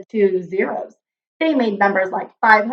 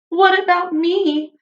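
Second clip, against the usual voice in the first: put-on high little-girl voice asking timidly; the little Zero tugging at Zero's arm, in a small high voice; 8 semitones higher than usual. A narrator's reading. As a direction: pipe up like a tiny child. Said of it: "asked a small voice".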